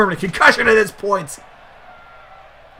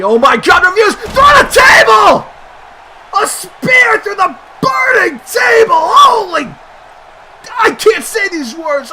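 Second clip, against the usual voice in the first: high, very loud commentator frenzy; real ringside hysteria; shocked screaming; 11 semitones higher, 8 dB louder, slower than usual. Real, not acted. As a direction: scream the disbelief over the crash, stretching words. Spear through burning table.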